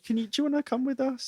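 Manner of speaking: high, soft, quick pleading question; mock concern. Woman inviting the possessed friend along, high soft mock concern. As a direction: imitate her coaxing in a small high voice.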